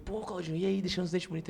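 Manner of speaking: retold friendly greeting. Retold cheerful greeting to waiter.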